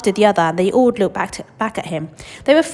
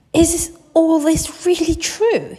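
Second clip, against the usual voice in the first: high, slow, wide-eyed wonder; amazed child; 10 semitones higher, 5 dB louder, slower than usual. Acted. Charlie asking his grandfather, slow amazed boy voice. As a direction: ask slowly in wonder.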